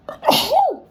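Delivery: sudden loud sneeze. Real, not acted. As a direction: let out a real sneeze. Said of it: "I'm gonna sneeze".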